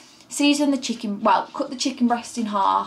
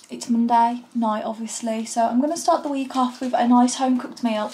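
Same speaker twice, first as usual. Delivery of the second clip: normal talking voice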